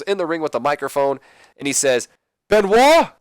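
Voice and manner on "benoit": shouted name, much higher and louder. Retold or imitated. Kurt calling out Benoit in the ring, loud shout. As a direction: bellow his name into the ring. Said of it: "I said that really loud".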